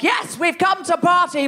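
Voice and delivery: high, shouted party cheer; partying crowd. Retold or imitated. Comic imitates Sheffield audience, high party shout. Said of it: "and they were like".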